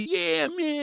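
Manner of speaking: high, hyped-up crowd cheer; drawn-out, high mock cheering; imitated crazy hype. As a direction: imitate fans going wild. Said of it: "everybody was going crazy".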